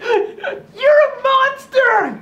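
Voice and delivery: high, slow, screaming cry; terrified scream. Acted. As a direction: scream it in sheer terror. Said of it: "he's freaking out".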